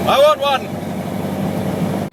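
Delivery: high, very slow, drawn-out cry; longing. Real, not acted. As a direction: stretch the wish out. Thrilled, drawn-out wish.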